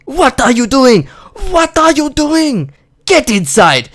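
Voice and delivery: put-on high, loud scolding in a Singapore-English accent; angry Singapore-English accent yell. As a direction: imitate an irate mum shrieking in Singapore-English accent. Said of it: "in her Singapore slash English accent".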